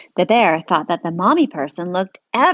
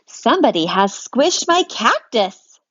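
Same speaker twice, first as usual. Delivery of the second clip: louder cross dad; a dad finding his cactus squished; cross daddy, louder; indignant daddy; 3 semitones higher, 5 dB louder than usual. Acted. Daddy voiced in story.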